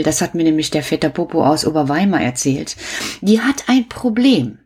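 usual speaking voice

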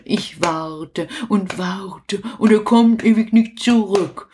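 ordinary talk

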